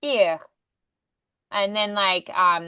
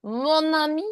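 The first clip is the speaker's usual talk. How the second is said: higher-pitched French accent greeting; welcoming host with a French accent, pitched up; Lumiere; 6 semitones higher than usual. Shown as a demonstration. Coach introduces the next character to a student, French accent. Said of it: "We're gonna be Lumiere this time".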